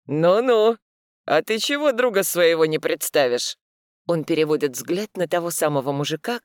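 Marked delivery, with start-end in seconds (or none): 0.1-3.6 skeptical. Acted. Mikhail presses skeptically.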